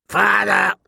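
squawked high like a mocking raven; mock him like a bird; taunting raven. A narrator's reading. The raven taunting Jon from Mormont's shoulder, squawking. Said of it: "taunted the old raven".

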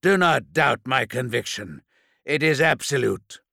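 sharp, angry retort; snapped. Acted. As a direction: snap it back in anger. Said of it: "Narek snapped".

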